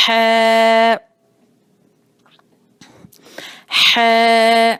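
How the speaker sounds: ہے held long with a breathy ح. Shown as a demonstration. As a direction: stretch ہے out with breath. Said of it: "آواز کو تھوڑا سا لمبا بھی کریں گے". (ہے لمبا، سانس کے ساتھ)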